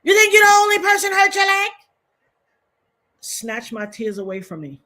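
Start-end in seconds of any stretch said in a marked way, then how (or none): 0.0-1.7 high, loud screaming mother; furious mother. Retold or imitated. Mother yelling at her crying child, furious shout. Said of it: "My mother said".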